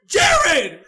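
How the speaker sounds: loud, high imitated mother's yell; hammed-up angry shout; mom yelling. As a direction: holler the name like a furious mom. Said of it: "my mom yell at my brother".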